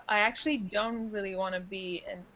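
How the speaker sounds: ordinary talk, nothing marked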